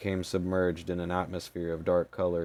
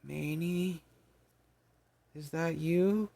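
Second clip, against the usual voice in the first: squeaky fairy voice; 10 semitones higher, slower than usual. Acted. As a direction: speak like a tiny weak fairy. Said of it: "She had a squeaky voice".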